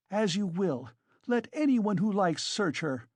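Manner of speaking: agitated woman, moderately heightened; mild defiant cry. A narrator's reading. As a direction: dare them with indignant conviction. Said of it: "cried Katerina Ivanovna".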